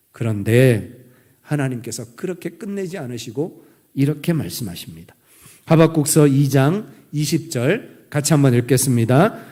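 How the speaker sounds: normal talking voice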